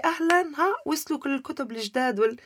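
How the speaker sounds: high, bright welcome to a regular customer; cheerful greeting. Retold or imitated. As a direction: greet her like a happy shopkeeper. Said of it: "فبقول لي".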